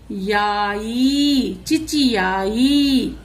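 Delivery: चिचियाई built up slowly, ची ची then याई; चिचियाई drawn out slowly syllable by syllable; slow sounding out. Shown as a demonstration. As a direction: piece चिचियाई together slowly. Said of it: "फिर च को छोटी ई".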